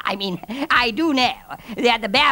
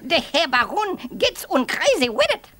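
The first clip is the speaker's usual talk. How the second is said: ordinary talk, nothing marked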